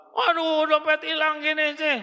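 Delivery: high, whiny flustered wife; fretful wife. Acted. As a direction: panic over the lost wallet. Wife lost her wallet.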